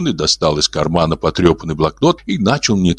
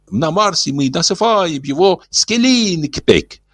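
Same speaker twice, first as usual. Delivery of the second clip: gentle alien voice; 10 semitones higher than usual. Acted. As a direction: speak like a kindly old alien. Ulin voiced.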